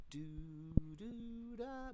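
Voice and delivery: slow, high sung syllables; pretty, long notes. Shown as a demonstration. Bandleader asking the band to make it pretty, long sung notes. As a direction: sing it smooth and drawn out. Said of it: "Make it real pretty".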